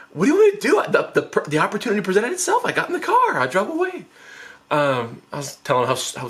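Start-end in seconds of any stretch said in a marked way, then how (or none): 0.1-4.1 high-pitched protesting excuse; hammed-up whiny self-justification; defensive. Brother defends himself when confronted.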